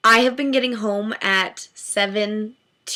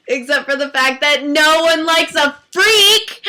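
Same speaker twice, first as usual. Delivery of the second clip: self-mocking laugh; 4 semitones higher, 5 dB louder than usual. Real, not acted. Joking self-mockery.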